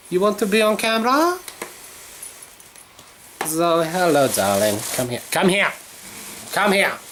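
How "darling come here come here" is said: ordinary delivery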